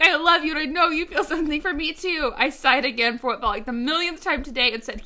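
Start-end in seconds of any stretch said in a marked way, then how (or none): 0.0-2.3 high, distraught, hammed-up plea; pleading ex-girlfriend. A narrator's reading. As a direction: wail it like a jilted lover. Ex pleading in story quarrel.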